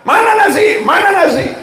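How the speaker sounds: hungry husband yelling for dinner, lightly hammed; mock shouting husband. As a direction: demand the rice like an impatient husband. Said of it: "teriak-teriak".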